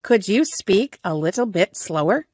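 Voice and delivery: usual speaking voice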